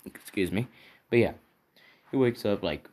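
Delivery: ordinary talk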